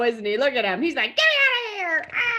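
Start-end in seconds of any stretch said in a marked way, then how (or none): 1.2-2.3 high, mock-panicked cry; trapped kitty. A narrator's reading. Kitty struggling out of the snow, high pretend panic. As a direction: squeal for rescue as the cat. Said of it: "He's trying to get out of that snow".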